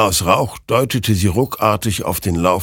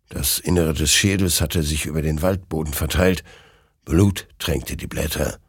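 ordinary talk

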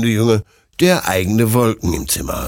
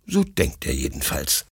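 plain reading voice

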